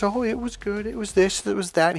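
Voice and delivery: high, softened, strained-casual imitation; keeping his cool. Husband trying to get past his wife's question, keeping cool. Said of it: "he's really trying to keep his cool".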